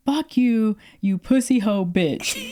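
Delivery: slow, drawn-out mock insult; imitated angry cursing. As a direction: curse them out as the thwarted caller, retold. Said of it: "He was mad".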